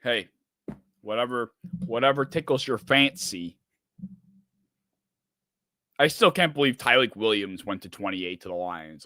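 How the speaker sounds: ordinary talk, nothing marked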